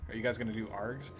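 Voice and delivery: normal talking voice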